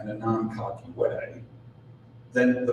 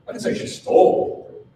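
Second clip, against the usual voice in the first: "stole" punched, louder; stress on stole; 7 dB louder than usual. Shown as a demonstration. Seminar speaker demonstrating meaning through stress, loud stress on "stole". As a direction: hit "stole" hard. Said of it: "every single time I say these seven words".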